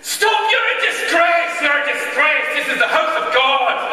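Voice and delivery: high, slow shouted rebuke; furious teacher shout. Retold or imitated. Choirmaster catching boys fighting, furious shout. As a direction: bellow it like an outraged choirmaster. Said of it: "this voice going".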